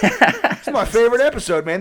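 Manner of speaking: cheerful bum oblivious to the call; stoner voice. Pretend. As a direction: pretend homeless guy enjoying his show. Homeless self-imitation watching TV.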